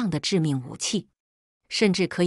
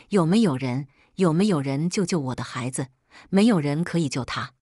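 plain reading voice

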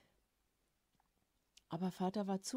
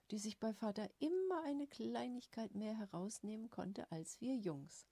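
usual speaking voice

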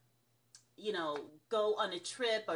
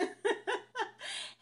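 high rising giggle; giggle, caught out; laugh. Real, not acted. Laughs at touching the screen.

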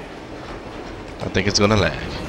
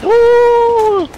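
excited whoop; 7 dB louder than usual. Real, not acted. Excited gamer reaction.

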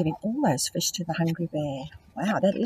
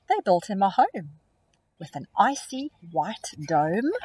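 put-on expressive read-aloud for young children; storybook reading; slower than usual. A narrator's reading. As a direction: read it like a picture book to kids. Rhyming story narration.

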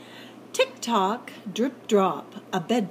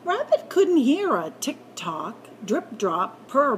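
plain reading voice

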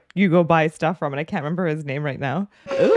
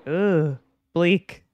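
ordinary delivery

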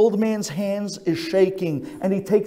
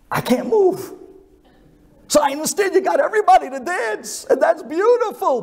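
put-on pleading old man; 12 semitones higher, 3 dB louder than usual. Old man begs rabbi to sit.

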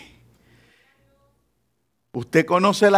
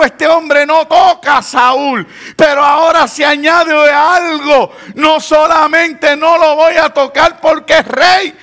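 high, forceful sermon delivery; full-force preaching; 8 semitones higher, 6 dB louder than usual. Acted. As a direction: drive each phrase home like a fervent preacher.

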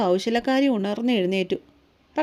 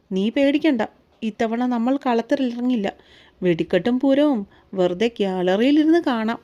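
ordinary delivery, nothing marked

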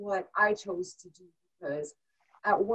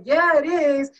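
insistent; 6 semitones higher, 9 dB louder, slower than usual. Retold or imitated. Insisting to doubting rink crowd.

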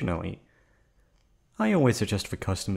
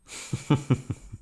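breathy mocking giggle; 6 semitones higher than usual. Villain laughs at drugged guest.

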